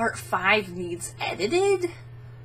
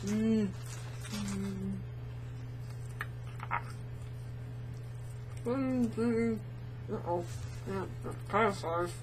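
mouth full; 11 dB quieter than usual. Real, not acted. Mouth stuffed with marshmallows.

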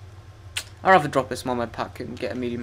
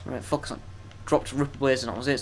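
normal talking voice

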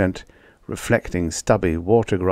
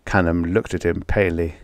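ordinary delivery

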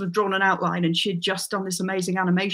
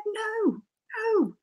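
very high, softer son's voice through laughter; imitating son, laughing; 12 semitones higher, 5 dB quieter than usual. Son rejects mum's accent, high laughing mockery. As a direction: laugh while playing the scornful teenager. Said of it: "And he said".

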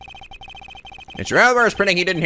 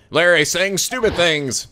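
ordinary talk, nothing marked

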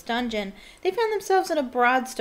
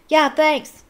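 quick raised call, higher and louder; shouted; 6 semitones higher, 8 dB louder than usual. A narrator's reading. Ron answering the Fat Lady as he leaves, shouted. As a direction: toss it loudly over the shoulder. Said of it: "Ron shouted over his shoulder".

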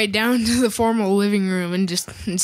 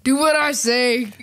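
slow, higher mock command; dad impression; 4 semitones higher, slower than usual. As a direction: mimic a bossy father laying down the law. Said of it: "do an impression of me".

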